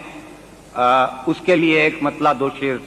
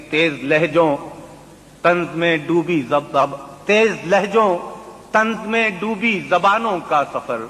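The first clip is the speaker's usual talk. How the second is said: poetry recitation; 7 semitones higher than usual. Acted. Mushaira recitation.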